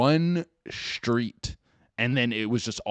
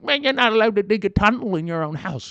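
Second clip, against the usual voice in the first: high, loud mocking whine; mock the nagging neighbor; loud, whiny mock of a fussy neighbor; whiny complainer; 12 semitones higher, 8 dB louder than usual. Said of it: "And you're like".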